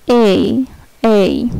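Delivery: ordinary delivery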